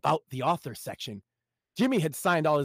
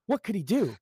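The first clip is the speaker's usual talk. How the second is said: normal talking voice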